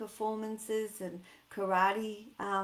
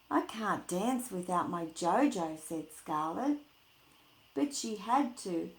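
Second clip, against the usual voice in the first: lively, louder storybook read; reading to children; 2 semitones higher, 6 dB louder than usual. Acted. Teacher reading a picture book to her class, lively. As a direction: bring the picture book alive for kids.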